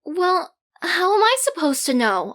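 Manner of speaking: defensive. Acted. Defensive retort.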